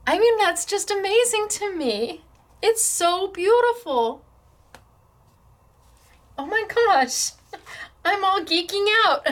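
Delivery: high, thrilled gushing; fangirling. Real, not acted. Crafter moved by a viewer's sample, gushing high. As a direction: gush over it, voice up high. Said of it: "I'm fangirling right now".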